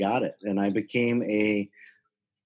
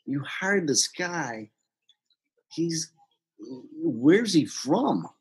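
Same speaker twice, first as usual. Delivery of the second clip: retold boss, higher, halting and incredulous; disbelieving boss; 6 semitones higher, much slower than usual. Boss questioning a manager about a new hire, incredulous. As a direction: imitate a doubtful boss, drawn-out and higher.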